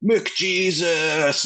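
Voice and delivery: high, drawn-out mock holler; imitated drunken fans' yell. Drunk Edmonton fans yelling at each other, imitated yell. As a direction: stretch it out like festival fans hailing a hero. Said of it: "all they would yell at each other".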